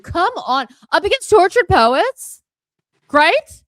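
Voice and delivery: high, loud and slow disbelief; outraged exclaim. Real, not acted. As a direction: belt it out in disbelief. Outraged at the award result.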